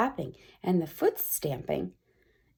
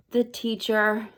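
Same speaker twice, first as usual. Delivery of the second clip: usual speaking voice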